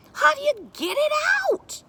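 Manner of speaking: very high, loud question; eager child. A narrator's reading. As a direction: demand the answer impatiently. Child demands to know, banging stick.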